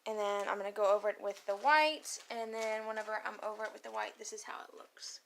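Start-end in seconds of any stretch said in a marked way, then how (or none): 2.3-5.2 soft hushed whisper. Real, not acted. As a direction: whisper quietly to camera. Whispering again while filming.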